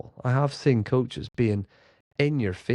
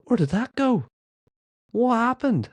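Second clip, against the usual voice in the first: playful mock surprise; 13 semitones higher than usual. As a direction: act amazed to cheer the kids up. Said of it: "We'll go".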